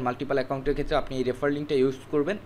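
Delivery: usual speaking voice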